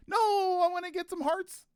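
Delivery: high, whiny protest; whiny character voice. Pretend. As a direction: whine like a stubborn kid. Voicing game character replying.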